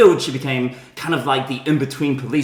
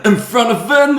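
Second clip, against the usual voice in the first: mock horror; 8 semitones higher, 5 dB louder than usual. Mock horror retelling a killing.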